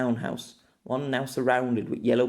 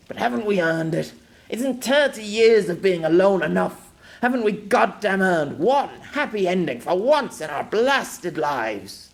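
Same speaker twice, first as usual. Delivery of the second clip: loud, raised, emphatic plea; anguished protest; 8 semitones higher, 6 dB louder than usual. Acted. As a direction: build to an exasperated, pitched-up outburst. Man pleads against parting.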